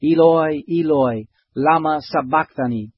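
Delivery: narrator's restrained cry to heaven; crying out loudly. A narrator's reading. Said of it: "cried with a loud voice".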